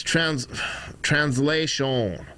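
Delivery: slow, lightly accented "translation" in a mock Russian accent. Shown as a demonstration. Streamer demonstrating how to say "translation" with a slight mock Russian accent. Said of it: "That's how you say it with an accent".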